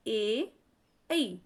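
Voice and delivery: ए then ऐ said slowly and clearly, one by one; crisp vowel drill; front vowel demo. Shown as a demonstration. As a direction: model ए and ऐ from the front of the tongue. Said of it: "तो ये आगे हिस्से से".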